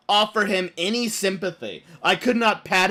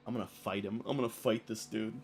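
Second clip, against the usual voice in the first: lower, much softer threat under the breath; a hushed, low muttered threat; 8 semitones lower, 13 dB quieter than usual. Retold or imitated. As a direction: grumble it to yourself. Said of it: "I was just like".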